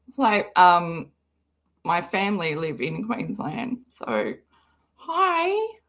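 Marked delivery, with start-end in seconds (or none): none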